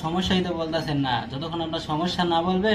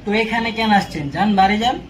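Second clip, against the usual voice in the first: higher, louder, fed-up scolding; exasperated dismissal; 4 semitones higher, 4 dB louder than usual. Acted. Doctor ordering a difficult patient home, exasperated dismissal. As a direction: send them off with raised voice.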